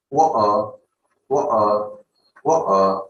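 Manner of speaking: "wa-, uh" as water with no T, only a throat catch; glottal stop. Shown as a demonstration. Teacher models glottal T, "wa-, uh" with a stop. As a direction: cut "wa-, uh" in the middle at the throat. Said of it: "this is how you can utilize".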